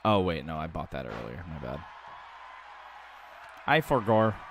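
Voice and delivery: ordinary talk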